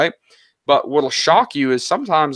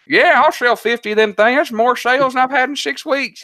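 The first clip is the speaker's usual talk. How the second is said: put-on Southern accent; 8 semitones higher than usual. Seller says yes to selling fifty in a hammed-up Southern accent. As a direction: play the happy country seller in a thick Southern accent. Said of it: "You like my Southern accent?".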